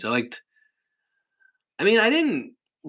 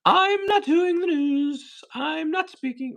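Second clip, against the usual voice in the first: much higher, slow mock-huffy voice; imitating Robin; 3 dB louder, slower than usual. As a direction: pout it out like a diva refusing to work. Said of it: "Robin decided she wasn't gonna do the news".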